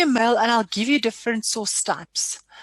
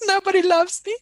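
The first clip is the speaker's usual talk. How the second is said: mock sad whine; 9 semitones higher, 6 dB louder than usual. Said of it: "I was very, very sad".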